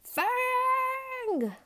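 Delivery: high, drawn-out bellow of a name. A narrator's reading. Bungle calls his dog Fang, a long bellow. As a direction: bellow it like calling a dog home. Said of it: "bellowed Bungle".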